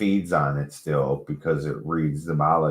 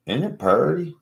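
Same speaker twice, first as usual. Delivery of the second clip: higher, cooing, as if showing off; high cutesy voice; 8 semitones higher than usual. Pretend. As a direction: coo it up high like a child. Repeats line, higher and cuter.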